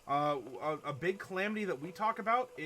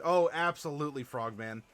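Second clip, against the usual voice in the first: normal talking voice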